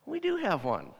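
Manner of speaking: high, ringing announcement; announcing the answer, the Word; excited reveal. Acted. Preacher revealing the answer to the church, excited high reveal.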